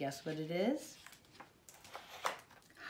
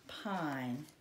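usual speaking voice

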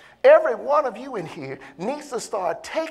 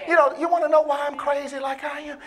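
normal talking voice

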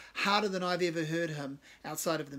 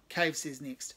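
usual speaking voice

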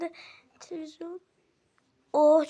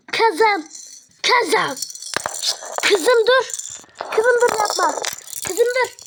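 loud, raised-voice pleas to stop; urgent scolding; 4 semitones higher, 5 dB louder, slower than usual. Acted. Mother stopping her daughter's attack, urgent shouting. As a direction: call out sharply to break up a fight. Mother breaking up sibling fight.

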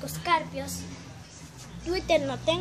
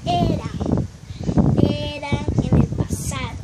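louder, leaning on eran; pointed correction; 11 dB louder than usual. Real, not acted. Girl corrects herself about former friends, loudly pointed. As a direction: stress that it's over now.

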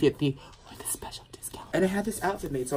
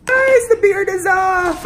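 excited shout; 13 dB louder, slower than usual. Real, not acted. Big reveal after shaving.

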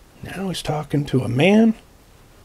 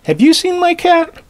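high, loud call for a lost cat; 14 semitones higher, 9 dB louder than usual. Acted. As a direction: shout the question across the street. Boy calls out searching.

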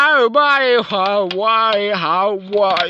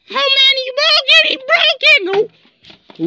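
high, loud cry that the toy broke; upset cry; 3 dB louder than usual. Real, not acted. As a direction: blurt it out high in dismay.

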